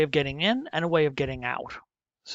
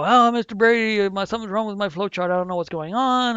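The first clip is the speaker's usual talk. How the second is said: high, pleading student voice, louder at first; whiny student; 5 semitones higher, 5 dB louder than usual. Retold or imitated. Teacher imitating a student asking for help, in a pleading voice. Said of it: "A student will go".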